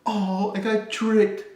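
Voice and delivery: mocking whine. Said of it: "you're pretty much telling everyone".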